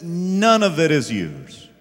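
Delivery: preaching Jesus' words at full force. Acted. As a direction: thunder each "not your". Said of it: "Jesus says".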